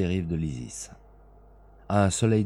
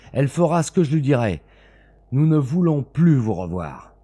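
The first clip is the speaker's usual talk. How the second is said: loud, higher furious refusal; angry shouting; 5 semitones higher, 5 dB louder than usual. A narrator's reading. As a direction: slam the door on him. Lee shouts during quarrel. (refus furieux et crié)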